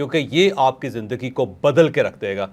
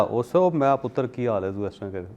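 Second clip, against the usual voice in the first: loving father; 4 semitones lower, 6 dB quieter than usual. Retold or imitated. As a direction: coo it gently like a doting dad. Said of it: "मैं इतने प्यार से उसके साथ बोलता हूँ". (बच्चे से बहुत प्यार से, धीरे और नरम आवाज़ में)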